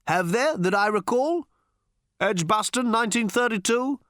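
high, hesitant cricket commentary chat; radio commentators. A narrator's reading. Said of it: "said one radio commentator to another".

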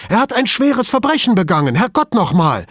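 high, loud furious tirade; outraged colleague rants at Brunetti, high and loud; angry outburst. A narrator's reading. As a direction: rage at him about the poison. Furious rant about poisoned water.

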